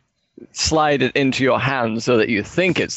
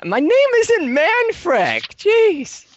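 indignant squeak; slower than usual. Acted. Half elf indignant at being misnamed.

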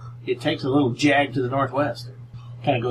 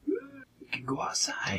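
whisper; 13 semitones higher, 9 dB quieter than usual. Acted. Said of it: "DM whispers the exit".

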